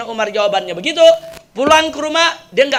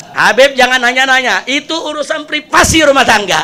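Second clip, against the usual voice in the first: mock congregation shout; 5 semitones higher, 7 dB louder than usual. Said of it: "Dijawab sama jamaah".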